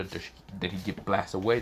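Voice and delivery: ordinary delivery, nothing marked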